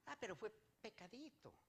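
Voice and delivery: small, soft voice making light of it; mock excuse. Preacher imitating a believer excusing a little sin, soft mock excuse. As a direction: play down the fault in a softened voice.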